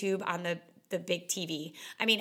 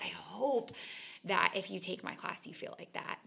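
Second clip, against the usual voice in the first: soft, half-whispered wish; hushed hope; 5 dB quieter than usual. Real, not acted. Instructor hoping her students feel the same, whispered. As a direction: breathe it out like a private hope.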